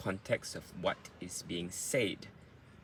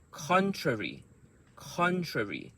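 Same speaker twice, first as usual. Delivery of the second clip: Contrary with CON high, falling away; word stress demo; 6 semitones higher than usual. Shown as a demonstration. Teacher drills Contrary for learners, stressed on high CON. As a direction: punch the first syllable of Contrary high. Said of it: "those sounds are the strong sounds".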